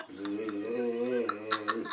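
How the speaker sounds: silly fish blub; laughing blub. Shown as a demonstration. Showing the favourite blub.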